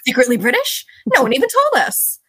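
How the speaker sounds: incredulous, pitch climbing on the protest; mock disbelief. Acting out viewers shocked by a secretly British actor, mock disbelief. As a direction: play up the outraged surprise.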